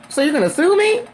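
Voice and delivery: high-pitched, rising challenge; mock-incredulous retelling; incredulous question. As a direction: ask it like you can't believe it, voice climbing high.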